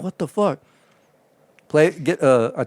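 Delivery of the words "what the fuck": higher, baffled exclamation; confused. Retold or imitated. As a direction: react to the calls blowing up. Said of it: "And I'm like".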